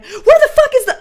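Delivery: high, loud exasperated demand; mock angry outburst. Host imitating their own fiery reaction at home, comically mad. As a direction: explode while hunting for something, half laughing. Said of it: "think of me as mostly mad".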